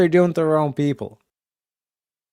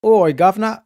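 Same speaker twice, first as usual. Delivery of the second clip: put-on British accent, higher pitched; mock British guy; 7 semitones higher than usual. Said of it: "the British guy goes".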